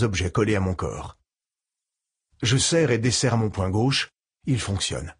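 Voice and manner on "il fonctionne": ordinary delivery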